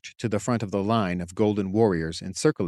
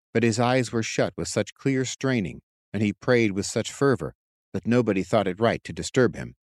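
ordinary delivery

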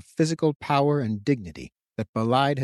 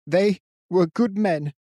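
tearful anger; 7 semitones higher, 3 dB louder, slower than usual. A narrator's reading. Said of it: "anger poured out in hot streaks".

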